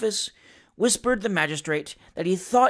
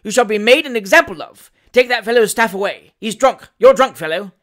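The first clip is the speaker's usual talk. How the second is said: loud, high magistrate's tirade; irate rebuke from the bench; furious; 4 semitones higher, 9 dB louder than usual. A narrator's reading. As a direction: hand down threats and accusations angrily. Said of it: "said the furious magistrate".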